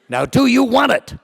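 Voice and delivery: loud, high demanding bark; a Marine daring him to say yes; challenging shout. Retold or imitated. Tough major to young officer, loud challenge.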